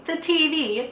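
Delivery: plain reading voice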